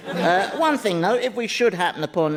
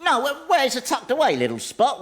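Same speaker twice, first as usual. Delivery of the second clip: higher-pitched, hurried excuse; flustered cover-up; 8 semitones higher than usual. Acted. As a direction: jump in to cover the blunder. Hastily covers companion's blunder.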